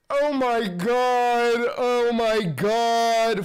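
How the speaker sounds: slow, loud, drawn-out oh my god; a loud, aghast slow-motion gasp of words; stunned disbelief. Real, not acted. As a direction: stretch each word out in real shock. Reacting to attack news.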